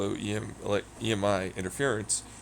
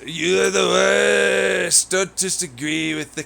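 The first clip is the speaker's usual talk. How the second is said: drawn-out playful yell; mock outrage; 8 semitones higher, 7 dB louder, slower than usual. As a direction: stretch it out, mock offended.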